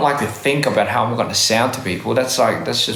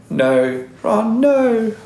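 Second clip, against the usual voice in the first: put-on Australian-accented movie quote, rising high; hammed-up Australian take on the line; 10 semitones higher, much slower than usual. Trying out a Harry Potter meme line, exaggerated Australian accent. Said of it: "we sound Australian when we do it".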